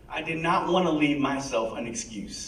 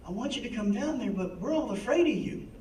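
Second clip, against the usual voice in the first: soft, higher, gentle imitation of a kind counselor; soothing voice; 6 semitones higher, 6 dB quieter than usual. Drug counselor Judy gently coaxing an angry patient, soothing. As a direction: coax him down tenderly like a caring counselor. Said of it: "she had this voice that was very soothing".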